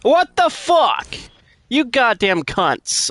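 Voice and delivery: furious shout. Real, not acted. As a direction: explode at the enemies. Killed by grenades, raging.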